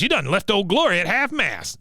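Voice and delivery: lightly acted drill-sergeant scolding; mock berating. Acting Cotton berating Peggy.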